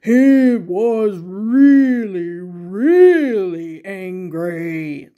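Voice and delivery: slow, loud, heavy anger; mock furious growl; angry. A narrator's reading. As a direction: drag out really angry like a fuming farmer. Reader voicing the angry farmer.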